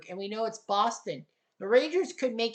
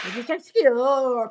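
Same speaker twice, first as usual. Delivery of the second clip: louder, drawn-out call; announcer call; 6 dB louder than usual. Acted. Announcer calling the play, louder and stretched. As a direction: sell it like a big moment.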